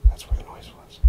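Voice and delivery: hushed, voiceless alarm; real hushed fright; frightened whisper. Real, not acted. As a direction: whisper to yourself on spotting danger. Frightened by a noise.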